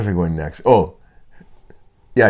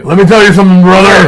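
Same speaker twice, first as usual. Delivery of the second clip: loud wrestler bellow, pitched up; Hulk Hogan; 7 semitones higher, 9 dB louder than usual. Acted. Stand-up's one-trick act, loud Hulk Hogan impression. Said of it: "Hulk Hogan impression".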